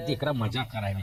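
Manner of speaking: put-on old villager. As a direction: play the pleased old man praising. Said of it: "એક દાદા મારી પાસે મને કે".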